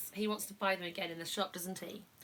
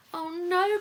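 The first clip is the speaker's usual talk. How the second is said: higher, louder dismayed oh no; crying out; 9 semitones higher, 7 dB louder than usual. Pretend. George finding the shop closed, crying out. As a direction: wail it in dismay. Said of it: "cried George".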